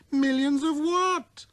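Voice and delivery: put-on high, light boy's voice; little prince voice. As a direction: speak like a wondering small boy. Prince asks.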